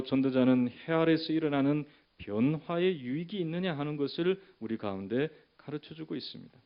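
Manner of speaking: ordinary delivery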